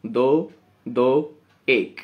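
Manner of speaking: दो, दो counted very slowly. Shown as a demonstration. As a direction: count the beats deliberately. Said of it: "आनंद का वजन क्या होगा". (दो, दो बहुत धीरे गिनते हुए)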